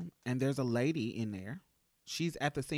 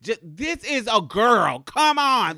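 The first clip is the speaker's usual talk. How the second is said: loud, much higher indignant rant, stretching key words; friends ranting; 7 semitones higher, 10 dB louder than usual. Retold or imitated. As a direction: insist hard she's plainly a girl. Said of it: "This is what my friends are saying".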